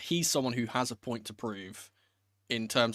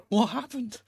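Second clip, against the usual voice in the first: surprised; 11 semitones higher, 5 dB louder than usual. Retold or imitated. Shocked reaction to injured player.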